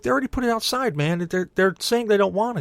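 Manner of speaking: high-pitched, arguing back; insistent justification. Retold or imitated. Acted argument over leftover food.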